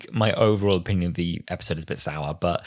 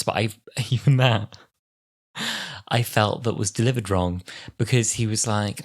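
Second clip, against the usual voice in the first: ordinary delivery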